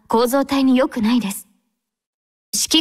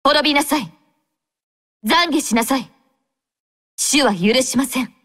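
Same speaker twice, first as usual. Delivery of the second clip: stern battle cry; 3 semitones higher than usual. Acted. Battle lines condemning an enemy.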